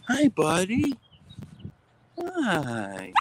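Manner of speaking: very high cooing hello. Real, not acted. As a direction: coo hi to the puppy. Greeting the dog.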